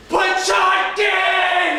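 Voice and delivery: repeating the order at full shout. Acted. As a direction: bellow it in frustration.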